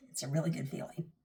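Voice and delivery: soft, breathy whisper. Real, not acted. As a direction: whisper it like a secret. Whispered repeat after "trust me.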